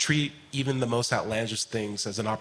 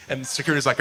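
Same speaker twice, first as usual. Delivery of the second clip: ordinary talk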